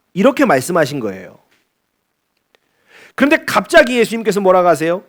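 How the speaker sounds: normal talking voice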